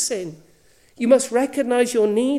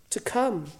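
ordinary delivery

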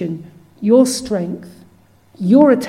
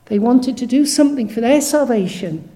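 ordinary delivery

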